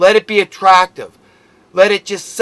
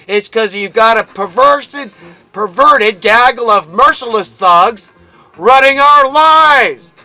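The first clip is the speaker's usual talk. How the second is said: heated, louder rant, pitch climbing higher; real anger; 3 semitones higher, 5 dB louder than usual. Real, not acted. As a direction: let the anger boil over at those in power. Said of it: "Yes, and I'm angry, I'm agitated, I'm frustrated".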